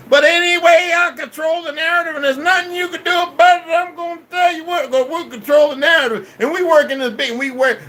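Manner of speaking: shouting way up high and loud, bragging; loud boastful rant. Real, not acted. Streamer shouting his boasts at his viewers, loud and high. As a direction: belt it out like you run the world.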